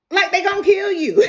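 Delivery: loud, high, laughing outburst; laughing shout. Real, not acted. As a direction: shout it through laughter at the absurdity. Ranting about horror movie victims.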